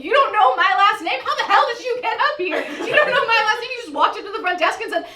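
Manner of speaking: escalating high-pitched outraged rant; imagined furious rant. Pretend. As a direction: unload on him in a shrill imagined tirade. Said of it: "I didn't say any of that to him".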